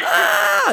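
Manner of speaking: very high excited shriek; delighted squeal. Real, not acted.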